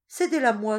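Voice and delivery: first plea, loud and high; pleading. Acted. Teresa begging Manuela for the goat, loud plea. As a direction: push the plea out hard.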